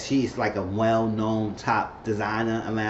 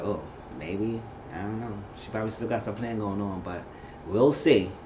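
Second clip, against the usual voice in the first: doubtful hush; 3 semitones lower, 7 dB quieter than usual. Real, not acted. Doubtful aside.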